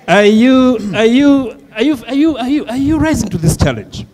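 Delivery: ordinary delivery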